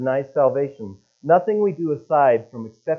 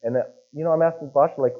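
normal talking voice

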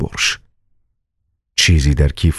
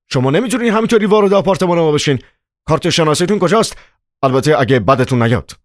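fast, high-pitched objection; agitated protest; 10 semitones higher, faster than usual. A narrator's reading. Chairman demands ID from intruders, agitated and high. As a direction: challenge them in a high, hurried voice, easing at the end.

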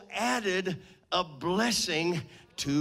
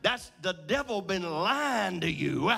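preaching at full force; 5 semitones higher than usual. Acted. Pastor proclaiming God's promises to the congregation, at full force. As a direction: build the fire, proclaim it to the rafters.

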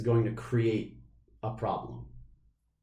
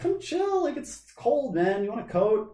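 high mock friendly; 11 semitones higher than usual. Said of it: "we're like".

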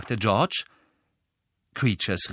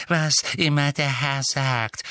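higher, submissive creature voice; servile elf; 6 semitones higher than usual. A narrator's reading. Bowing elf yielding to his master, servile. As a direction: fawn to the master with false deference.

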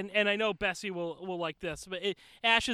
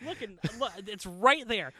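ordinary talk, nothing marked

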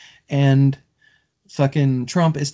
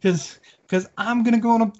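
much higher, pinched frustrated rant; frustrated venting; 9 semitones higher than usual. Real, not acted. Guest vents at friends about politics, high and frustrated. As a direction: vent it out, higher and tight. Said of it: "That's why we avoid politics".

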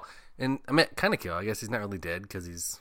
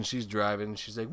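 usual speaking voice

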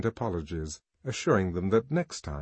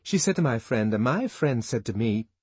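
gossiping Fanny; 2 semitones higher, 4 dB louder than usual. A narrator's reading. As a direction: rattle off the rumour eagerly. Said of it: "came the voice of Fanny Crown".

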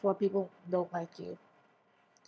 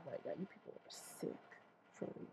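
much softer breathy whisper; whispered aside; 4 semitones lower, 20 dB quieter than usual. Real, not acted. Ranting at listeners, whispering in disgust.

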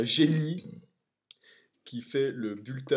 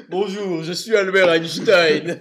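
slow, loud laughing Einstein voice; genie; 3 semitones higher, 13 dB louder, slower than usual. Acted. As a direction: announce yourself grandly, cracking up. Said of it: "mais le génie".

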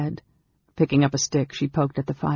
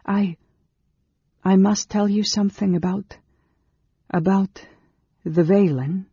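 higher, halting confession; haltingly, in a raised voice; nervous hesitation; 5 semitones higher than usual. A narrator's reading. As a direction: falter and restart, reluctant to name it.

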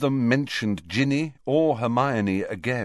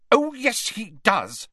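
high, indignant contradiction; flaring up; 13 semitones higher than usual. Acted. Ginny flaring up at Harry, indignant. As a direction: snap back hotly, starting high. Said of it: "flaring up at Harry".

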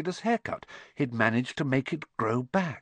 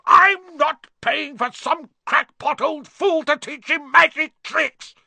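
much higher and louder, slow furious yell; 8 dB louder than usual. Acted. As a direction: explode at the giant in outrage. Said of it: "yelled Uncle Vernon".

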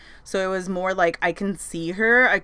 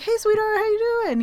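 much higher, sing-song reply; affectionate greeting; 4 dB louder than usual. Retold or imitated. Mary greeting her warmly back, sing-song. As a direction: coo it back fondly. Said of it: "She's like".

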